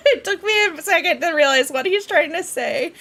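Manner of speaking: amused, words riding on a laugh. Real, not acted. Laughing at misread dialogue.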